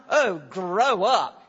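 loud, low, drawn-out put-down; acted dismissive jab, slow and loud; mock scolding. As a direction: scold them with heavy exasperation. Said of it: "what he's describing here is not".

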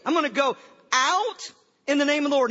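big, projected mock declaration; emphatic restatement. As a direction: proclaim it loudly, as if puzzling over it. Repeats David's line as a puzzle.

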